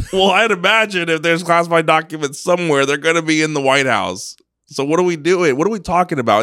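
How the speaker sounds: high, loud disbelief; incredulous. Real, not acted. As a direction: ask it in plain disbelief. Incredulous rhetorical questions.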